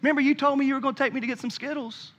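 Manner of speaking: put-on excited kids. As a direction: lightly voice the kids begging dad at the door.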